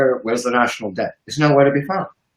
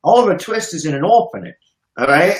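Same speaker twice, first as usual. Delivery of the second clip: normal talking voice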